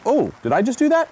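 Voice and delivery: mock-innocent dog discovering its bark; dog's surprised voice. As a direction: play the dog amazed at its own power. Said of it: "And he goes".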